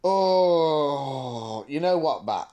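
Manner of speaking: ordinary delivery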